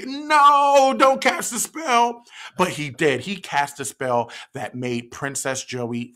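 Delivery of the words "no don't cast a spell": pleading no. Shown as a demonstration. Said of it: "Everybody say".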